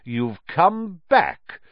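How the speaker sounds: loud, high and slow; furious Lucius. A narrator's reading. Lucius Malfoy confronting Dumbledore, furious and loud. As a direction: spit it at his enemy.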